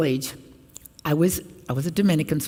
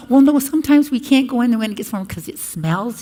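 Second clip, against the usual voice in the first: villager voice; 7 semitones higher, 6 dB louder than usual. Retold or imitated.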